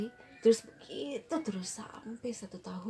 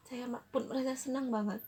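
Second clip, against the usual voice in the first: ordinary talk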